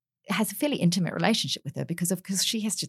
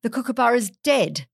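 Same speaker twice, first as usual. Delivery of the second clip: mock shock; 7 semitones higher, 4 dB louder than usual. Angela voiced in anecdote.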